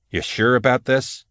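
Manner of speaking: ordinary delivery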